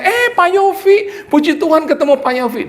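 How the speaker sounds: gushing church lady. Retold or imitated. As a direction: greet the pastor in a honeyed high voice. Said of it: "dia lihat saya". (suara ibu yang manis dan tinggi, dibuat-buat)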